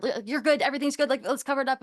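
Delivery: mock a teacher glossing over it; hammed-up everything's-fine voice; dismissive brush-off. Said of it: "It wasn't saying".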